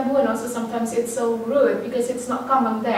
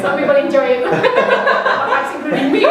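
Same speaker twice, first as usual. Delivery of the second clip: giggling confession; 5 dB louder than usual. Real, not acted. Student laughing as she admits she enjoys it, giggling. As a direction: giggle through a cheeky admission.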